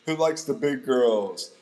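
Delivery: loud, slow hype-man call. Pretend. Streamer polling his viewers, loud playful call-out. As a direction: shout it out to the crowd, drawn out.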